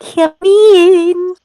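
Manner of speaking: put-on high, sweet girlish voice; angelic young girl voice. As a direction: sing it out like a little angel. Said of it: "The voice of a young girl, much like an angel".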